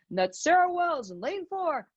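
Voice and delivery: announcer voice. Retold or imitated. Runner imitating the stadium announcer, lightly voiced. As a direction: imitate the PA call. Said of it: "hearing the announcer over the P A system say".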